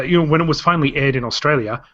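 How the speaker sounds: ordinary talk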